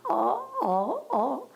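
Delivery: high, stammering oh oh oh; a young girl's stunned stammer; startled stammer. Retold or imitated. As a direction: gasp it out like a startled girl. Startled girl stammering at stranger.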